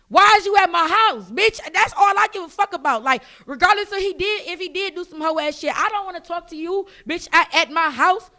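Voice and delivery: loud, high-pitched indignant telling-off; indignant confrontation. Retold or imitated. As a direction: demand an answer, fed up. Retold angry confrontation at her house.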